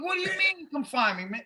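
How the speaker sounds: high-pitched retold protest; acted-out incredulous outburst; indignant disbelief. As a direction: question it in alarmed disbelief, pitch leaping up. Retelling alarmed argument with security.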